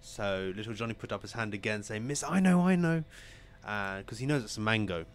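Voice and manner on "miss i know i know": high, excited plea to be picked; eager pupil. Acted. Little Johnny begs the teacher to pick him, eager. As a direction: call out high, bursting with the answer.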